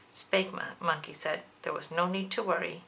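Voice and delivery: usual speaking voice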